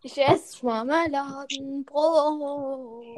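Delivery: slow, drawn-out gloating; slow taunting. Real, not acted. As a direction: stretch it out to tease.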